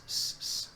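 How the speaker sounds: "s, s" hissed with the tongue slightly back; Castilian retracted s. Shown as a demonstration. Teacher demonstrating Spain's s, soft retracted hiss. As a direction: hiss "s, s" softly, edging toward sh. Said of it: "se vuelve un s".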